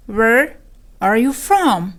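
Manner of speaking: "Where" alone with a rise, then a pause; broken into parts. Shown as a demonstration. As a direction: teach it as "Where ... are you from". Lesson repeats it in parts.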